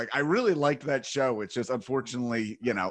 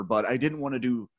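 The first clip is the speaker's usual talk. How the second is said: ordinary delivery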